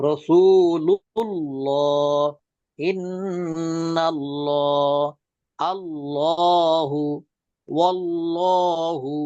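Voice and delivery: slow recitation of রাসূলুল্লাহ with a thick, dark L; heavy Allah. Shown as a demonstration. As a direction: draw each word out with a heavy lam. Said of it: "মোটা করে পড়তে হবে". (টেনে মোটা উচ্চারণ)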